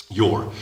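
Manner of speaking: Your said jor with a long rounded o. Shown as a demonstration. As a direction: stretch the o in Your. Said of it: "suena como, con dos O's". (Your, con dos O)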